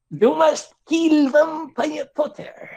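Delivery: very high, slow villain cry; hammed-up yell. Voldemort ordering heroine to kill Potter, hammed-up high yell. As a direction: draw out the command in a high mock wail. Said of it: "he yelled".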